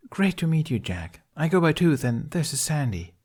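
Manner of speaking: high, chipper, upbeat voice; bubbly woman. A narrator's reading. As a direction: sound bright and eager. Tooth greets Jack.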